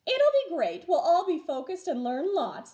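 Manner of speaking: high, bright-eyed teacher reassurance; cheerful teacher voice. A narrator's reading. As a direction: sell the trip with a high eager voice.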